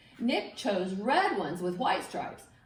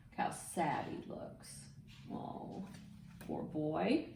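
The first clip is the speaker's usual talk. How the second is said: soft whisper of sympathy; whispered aside; 5 semitones lower, 10 dB quieter than usual. Real, not acted. Aside about sad picture.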